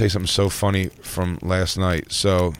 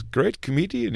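high, soft mock Indian accent; pitched-up Indian cabbie voice; 8 semitones higher, 5 dB quieter, slower than usual. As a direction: imitate an admiring Indian cab driver. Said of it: "Indian guy".

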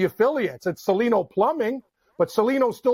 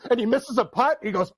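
ordinary talk, nothing marked

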